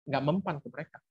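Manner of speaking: ordinary talk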